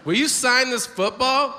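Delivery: high-pitched imitation of an eager fan; eager fan request. Honky friend asks a player to sign, high fan voice. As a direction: ask high and hopeful, as the fan.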